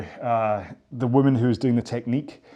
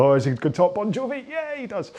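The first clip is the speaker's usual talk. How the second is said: mock-eager, rising high; 模仿听众起哄; 8 semitones higher than usual. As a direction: act out an eager listener's reaction. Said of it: "and then go".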